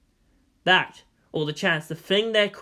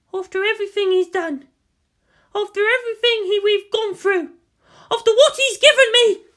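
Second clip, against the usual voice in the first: loud, much higher furious cry; furious outburst; 8 dB louder than usual. Acted. Mana raging at the poster of Magnus Tancred, loud and furious. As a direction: explode with betrayed rage.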